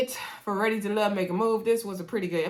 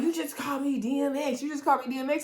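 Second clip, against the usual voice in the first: lightly acted, higher-pitched indignation; mock offended; 6 semitones higher, faster than usual. Woman retold confronting her date over a name, mock offended. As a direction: raise the pitch, imitating an affronted date.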